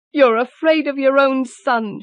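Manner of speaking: high accusing cry; scornful cry. Acted. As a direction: throw it at him in scorn. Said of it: "she cried".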